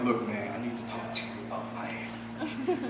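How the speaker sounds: hushed, low secretive whisper; very soft, furtive murmur. Acted. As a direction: confide it under your breath to the doctor. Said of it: "Why are you whispering?".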